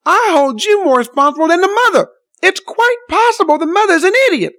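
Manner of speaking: pitched high, rising in outrage; indignant rebuke. Retold or imitated. Rebuking the bystander, voice climbing in outrage. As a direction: scold her, voice climbing in anger. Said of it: "I said to her".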